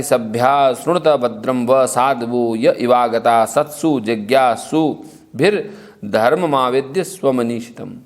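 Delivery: measured Sanskrit chant, slowing at the end; shloka recitation. Acted. Storyteller recites the king's verse in chant. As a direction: intone the verse like scripture.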